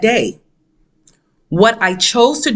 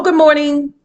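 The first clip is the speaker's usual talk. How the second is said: higher-pitched, imagined pleasant hello; mock polite greeting; 8 semitones higher than usual. Voicing the polite greeting she never got.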